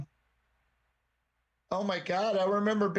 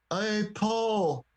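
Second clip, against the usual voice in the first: Liverpool accent. Shown as a demonstration. Showing the most famous Liverpool accent, Scouse imitation. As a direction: put on a quick taste of Liverpool accent. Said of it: "somebody from Liverpool, and the most famous accent".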